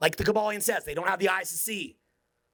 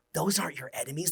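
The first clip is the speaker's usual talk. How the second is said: ordinary talk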